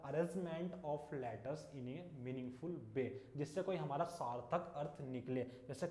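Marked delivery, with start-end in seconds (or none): 0.0-3.4 very slow dictation, word by word; dictating slowly. Shown as a demonstration. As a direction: spell it out so students can write it down. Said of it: "ध्यान रखना कि word क्या होता है". (बहुत धीरे, लिखवाते हुए)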